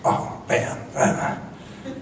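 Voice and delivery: breathy under-the-breath moan, slowed; muttered lament. Retold or imitated. Producer mutters to himself while staring at Tricia: whispered lament. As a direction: groan it quietly to yourself, almost a whisper. Producer ogling actress at party.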